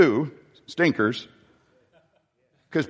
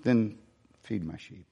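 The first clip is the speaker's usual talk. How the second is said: тихое мягкое поручение; hushed gentle command; 5 semitones lower, 9 dB quieter than usual. Acted. Jesus gently commissions Peter.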